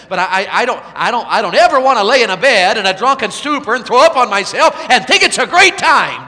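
high, loud, ringing revival preaching; full-force preaching. Acted. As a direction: drive the gospel call home to the crowd.

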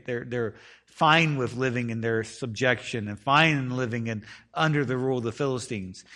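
ordinary talk

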